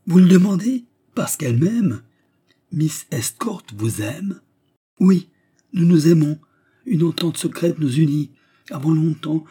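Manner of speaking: high, loud, triumphant declaration; exalted lover. Acted. Suitor boasting of his secret love, exalted. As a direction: proclaim the secret love proudly.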